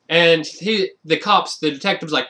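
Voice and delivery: usual speaking voice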